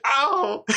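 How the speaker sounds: high, sudden mock yelp; kid yelp of pain. The kid shocked by his collar, a high mock yelp. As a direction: yelp like a kid who just got zapped. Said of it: "and he's like".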